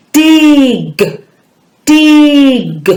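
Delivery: "Dig" stretched out high, long vowel and released g; "Dig" drawn out high so each sound is heard; emphasised phonemes. Shown as a demonstration. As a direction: hold the i in "Dig", then pop the g. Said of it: "really emphasise those words".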